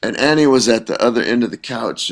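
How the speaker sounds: ordinary talk, nothing marked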